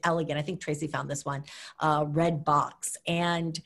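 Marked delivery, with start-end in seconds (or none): none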